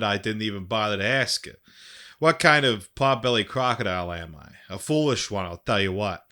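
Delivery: put-on Boston accent reading; hammy Boston accent, punchy start. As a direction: read it as an amateur Bostonian. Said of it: "transition into my Boston accent".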